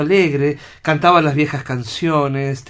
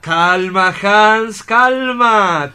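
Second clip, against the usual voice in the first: higher and louder, firm call for calm; loud, high, insistent plea for calm; urging calm; 5 semitones higher, 6 dB louder, much slower than usual. Acted. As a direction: raise your voice to settle him down. Doctor soothes the agitated assistant.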